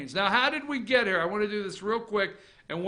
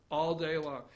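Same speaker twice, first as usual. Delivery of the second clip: ordinary talk, nothing marked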